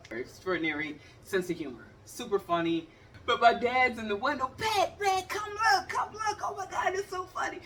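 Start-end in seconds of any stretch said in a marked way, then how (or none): 4.6-7.6 high, excited calling dad voice; father calling his wife to come look, excitedly. Retold or imitated. Voicing dad calling out laughing.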